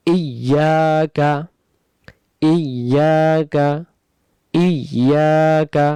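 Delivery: ইয়াকা drawn out slowly, each syllable held; slow drawn recitation. Shown as a demonstration. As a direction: chant ইয়াকা slowly with long vowels. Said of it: "তারপরে". (টেনে ধীরে তিলাওয়াত)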